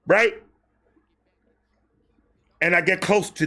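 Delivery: normal talking voice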